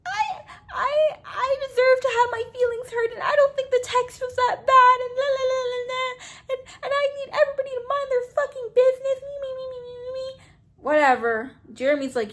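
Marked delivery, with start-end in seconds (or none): 0.1-10.4 high, whiny mock sob voice; hammed-up whiny cry imitation. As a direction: squeal it like a fake-crying brat. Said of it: "crying, she's like".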